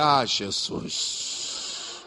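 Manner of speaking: slow, breathy whispered aside; whispered sigh. Retold or imitated. Pastor reacting to her confession, slow whisper. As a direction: let it out under your breath.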